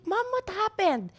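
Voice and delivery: high, drawn-out imitation of a worried question; slow, high-pitched retelling of concern; retold alarmed question. Daughter retelling how she questioned her crying mom, alarmed.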